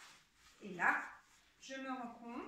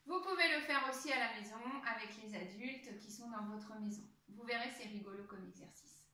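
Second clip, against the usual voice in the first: normal talking voice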